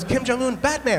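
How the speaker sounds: put-on high, loud, eager child insisting; excited kid. Cambodian boy insisting to the pastor, high and loud. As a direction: push the name like a proud discovery.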